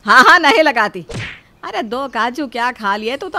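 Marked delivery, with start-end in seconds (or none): 0.1-1.0 loud mocking agreement; mother-in-law mocking the daughter-in-law, loud and sarcastic; sarcastic loud retort. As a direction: throw her words back at her, loudly.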